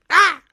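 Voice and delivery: loud high gull cry; seagull squawk. Retold or imitated. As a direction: squawk like a seagull that's been hit. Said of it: "The seagull goes".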